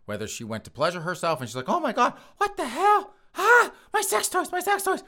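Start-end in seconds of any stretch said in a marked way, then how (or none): 1.7-5.0 much higher, louder falsetto wail over missing toys; hammed-up panic. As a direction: shriek like a hysterical woman, mock panic. Victim voiced discovering loss.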